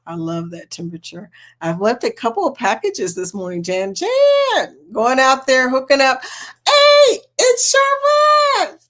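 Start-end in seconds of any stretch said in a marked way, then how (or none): 3.9-8.8 high, loud, thrilled hello to a friend; excited greeting. Real, not acted. Host greeting a viewer joining the livestream, excited and loud. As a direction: call out her name with delight.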